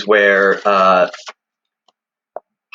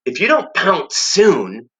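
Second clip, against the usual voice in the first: hissed urging; 7 semitones higher, slower than usual. Acted. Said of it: "Jive hissed".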